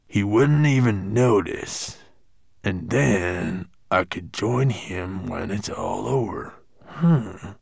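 musing dwarf voice, talking to oneself; Gravelbeard thinking. A narrator's reading. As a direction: weigh a sneaky plan, ending on a hum. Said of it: "thought Gravelbeard".

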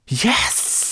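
high, loud, forceful serpent hiss; hissed cry. A narrator's reading. The serpent recognizes the scale, fierce hissed cry. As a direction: burst out with a long sharp S. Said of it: "she hissed".

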